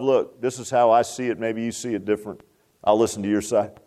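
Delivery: low, cool, measured reassurance; calm and loving. Acted. Spouse talking things through after arguing, calm and loving. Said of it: "sit down calmly and coolly and say with love".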